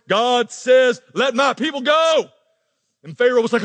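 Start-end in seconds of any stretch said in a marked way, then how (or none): 0.1-2.3 booming Charlton Heston proclamation, louder; a hint of a movie Moses, loud and grand; deep baritone voice. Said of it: "says with a deep dar-, baritone voice".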